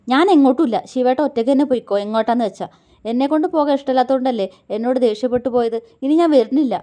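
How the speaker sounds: sulking wife's angry refusal. Acted. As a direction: throw it at him and storm off. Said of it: "ദേഷ്യത്തോടെ".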